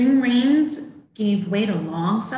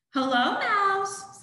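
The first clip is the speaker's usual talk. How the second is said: duck voice; 10 semitones higher, slower than usual. Acted. Duck greets mouse, high.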